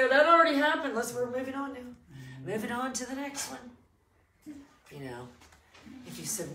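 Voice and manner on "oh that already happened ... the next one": excited. Acted. Acted breezy quote.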